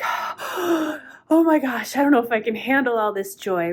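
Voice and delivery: high-pitched "oh my gosh", then tumbling words; overwhelmed by excitement. Acted. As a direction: act giddy, barely coping with the thrill.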